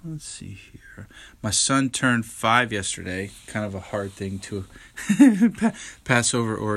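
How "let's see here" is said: whispered to himself; whispering. Real, not acted. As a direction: breathe it out under the breath. Thinking aloud to himself.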